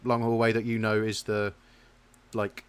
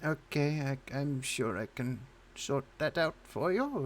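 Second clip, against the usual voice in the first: put-on old woman voice; 5 semitones higher, 4 dB quieter, slower than usual. GM keeps old barmaid voice.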